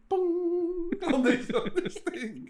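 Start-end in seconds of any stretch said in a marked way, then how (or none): 0.1-0.9 high, imitated gong note; mock bong. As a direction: ring it out like a gong. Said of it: "just going".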